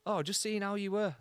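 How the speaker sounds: mock sweet checker. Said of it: "And they're like".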